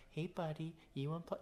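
the gentle way he does not talk to his son; soft gentle buddy. Shown as a demonstration. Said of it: "I'm not like".

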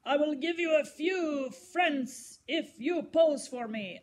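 put-on gruff painter bargaining with a girl. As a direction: growl out the offer, unhurried. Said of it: "A gruff voice interrupted then".

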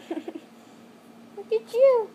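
high, slow cooing at pet. Real, not acted. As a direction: coo at the bunny.

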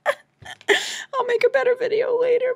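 very high, slow wail; sobbing sign-off, words stretched out high; crying. Real, not acted. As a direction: break down and squeal through the tears. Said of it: "I have to go cry now".

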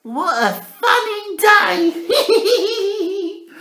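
very high, very slow, laughing voice; laughing Alfie. Acted. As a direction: drag it out through giggles.

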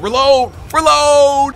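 frantic, loud and high reload; panicked gamer yell. Real, not acted. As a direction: scream it as the enemies close in. Panicking mid-fight.

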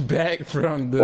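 slow laughing imitation of the intro; laughing mock repeat. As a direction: drag it out through a laugh. Friend laughs, mimics the intro.

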